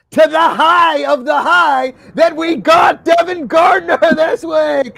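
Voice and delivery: high, loud hype shout; excited shout. Real, not acted. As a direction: build it up like a big reveal. Hyping the guest's arrival.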